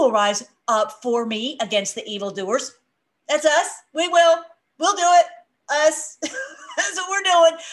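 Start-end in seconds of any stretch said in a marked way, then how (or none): 3.3-7.6 high, loud rallying cry to the flock; excited shout. Real, not acted. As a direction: shout it out with real fervour, laughing at the end. Rallying congregation, shouted affirmation.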